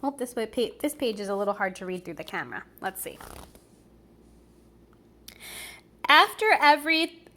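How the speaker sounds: put-on lively read-aloud for children; storybook reading. A narrator's reading. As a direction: read it like a bedtime picture book. Reading a picture book aloud.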